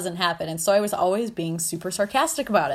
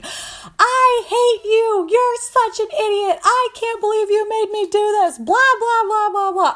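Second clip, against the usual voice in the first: very high, loud, drawn-out mock wail; hammed-up crying meltdown; hysterical tantrum; 14 semitones higher, 5 dB louder than usual. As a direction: screech it like a two-year-old throwing a fit. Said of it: "I am hysterical. I am, like, throwing a two-year-old tantrum".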